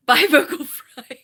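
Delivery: laughing. Real, not acted. Laughs saying goodbye to voice.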